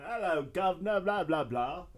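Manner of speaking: hammed-up Cockney greeting, governor-style; Cockney accent. Mocking Cockney for friends, jokey Cockney accent. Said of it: "Or a real Cockney accent".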